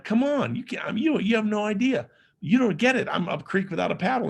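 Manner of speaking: high-pitched, exasperated retold pleading; acted desperate protest. Homeless teen argues with his best friend, acted desperation. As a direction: argue back with the voice pushed way up.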